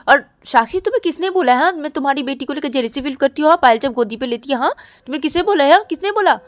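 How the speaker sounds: loud, high-pitched defensive outburst; indignant denial. Acted. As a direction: fire back offended questions. Ananya angrily denies the jealousy accusation.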